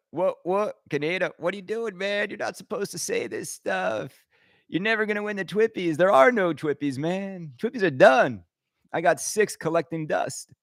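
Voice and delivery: high-pitched, softened mock protest; put-on objector voice. Mock critic telling Kaneda off for what he says, high put-on voice. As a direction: play a scolding critic in a high voice. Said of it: "can I talk like this".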